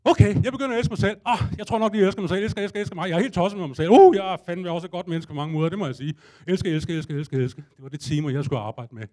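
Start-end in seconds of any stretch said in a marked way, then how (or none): 0.0-7.6 frantic self-love. Retold or imitated. Frantically forcing self-love on a drug.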